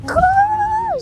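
high urgent yell to run; shouted. Real, not acted. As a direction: shout it, the bus is leaving. Urging friend to run for bus. (yüksek sesle bağırarak)

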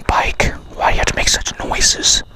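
hushed nervous whisper. Real, not acted. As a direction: whisper so the ghosts won't hear. Scolding bike quietly in dark.

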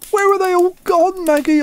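high, loud desperate cry; man searching the empty yard cries to his sister; cried out. Acted. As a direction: call out in panic for Maggie. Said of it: "I cried".

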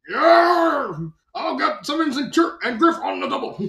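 high, loud whoop of eagerness; whoop, then rally the troops; hammed-up battle-ready yell; gung-ho soldier. Eager Red soldier replies.

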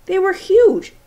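emphatic. A narrator's reading.

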